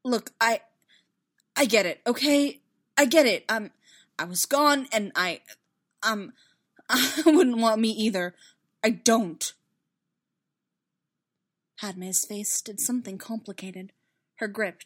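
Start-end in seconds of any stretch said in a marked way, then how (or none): 0.0-9.5 loud, halting, agitated stammer; agitated self-blame in broken phrases; searching for words. A narrator's reading. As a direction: stumble through it, upset and breaking off. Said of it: "searching for the words".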